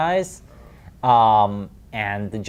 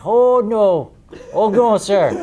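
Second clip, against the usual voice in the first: high, loud fretting servant; Irish-ish butler squeaking oh no; panicked butler; 10 semitones higher, 8 dB louder, slower than usual. Acted. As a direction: flap like a frightened butler. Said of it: "He's like".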